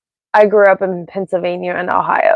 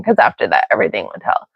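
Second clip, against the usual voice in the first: ordinary delivery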